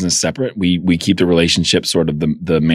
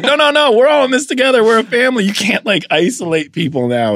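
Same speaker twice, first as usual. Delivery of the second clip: frantic high-pitched objection; mock pleading. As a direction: beg them to stay united, hammed-up. Pleading against blaming popcorn guy.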